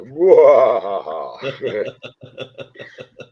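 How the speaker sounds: loud villain cackle; evil laugh. Acted. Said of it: "when I start doing this, you need to worry".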